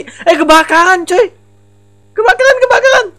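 very high, loud panicked cry; shouting in panic that there is a fire; alarmed shout. Real, not acted. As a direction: raise the alarm about the fire.

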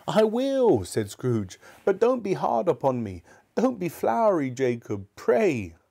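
put-on high, pleading old man's voice; pleading Scrooge. A narrator's reading. Scrooge begs his partner's ghost, high and pleading. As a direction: beg the ghost not to be hard on him.

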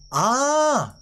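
ordinary talk

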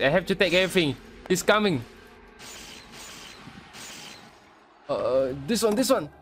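high-pitched, flustered panic; panicked. Real, not acted. Player grabbing everything as he's coming, panicked. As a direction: cry out as danger closes in. Chased while grabbing items.

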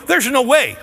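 loud, high imitation of a skeptic; mock doubter. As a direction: play the doubter's scoff. Voicing doubtful congregation.

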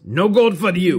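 high, loud catchphrase shout; loud mock-stern proclamation; mock refusal. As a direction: bellow it like a stern verdict. Acted reading of the marking.

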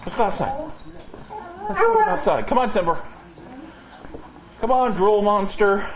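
high, sing-song coaxing of the dogs; dog-directed talk. Real, not acted. Owner coaxing Timber to come outside, high sing-song. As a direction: call the dogs out like a doting owner.

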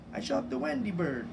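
loud, high boast, proud of himself; bragging. A narrator's reading. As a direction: crow about the shot. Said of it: "Tootles bragged".

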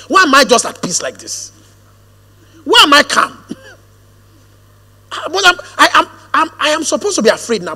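full-voiced, emphatic sermon delivery; rising pulpit fervor, pressing each phrase home; fervent preaching. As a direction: preach it to the congregation with conviction.

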